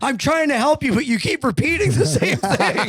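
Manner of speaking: acted-out exasperated helper, pitched high; mock exasperation. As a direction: imitate someone losing patience while trying to help. Acts exasperated prank-call victim.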